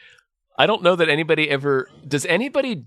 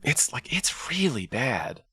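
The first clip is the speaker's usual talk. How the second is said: whisper; 3 semitones lower, 7 dB quieter, slower than usual. Real, not acted. Whispered aside about movie.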